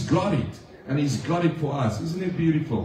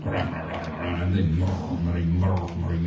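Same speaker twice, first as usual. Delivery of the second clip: low mock murmur; 9 semitones lower, 3 dB quieter, slower than usual.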